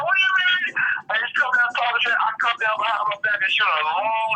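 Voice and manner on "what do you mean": high, sharp mock-angry challenge from a rival player; mock angry opponent. Trash talker retells the riled-up opponent, high mock anger. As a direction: squeak it out like an irritated defender. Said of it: "the guy used to get mad".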